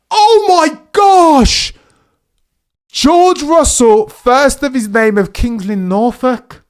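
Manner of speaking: high, loud, stretched cries of joy; thrilled yelling. Real, not acted. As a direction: cheer him round the outside. Overtake at turn one unfolds.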